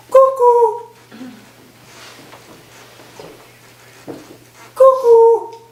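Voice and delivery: high, loud shrieked cuckoo call. Acted. Professor greeting new students with a loud high cuckoo call. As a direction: let out a bird's cuckoo at the top of the voice. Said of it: "What's with the cuckoos?".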